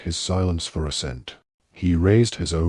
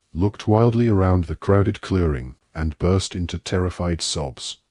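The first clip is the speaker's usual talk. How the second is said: ordinary delivery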